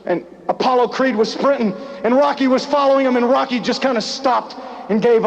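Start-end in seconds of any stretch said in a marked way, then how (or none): none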